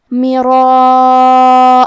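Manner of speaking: مراء stretched very long and loud; long madd demo. Shown as a demonstration. Teacher demonstrating the obligatory madd to students. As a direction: hold the alif of مراء for four counts. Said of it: "اس مد میں ہم اپنی آواز چار مد کے برابر لمبی".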